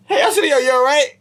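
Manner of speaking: called out high across the street; calling out. Retold or imitated. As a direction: hail a friend from a distance.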